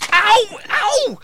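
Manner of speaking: sharp yelps of pain, rising; two quick ow yelps. Acted. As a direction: cry out as the lamp hits. Hit on head by lamp.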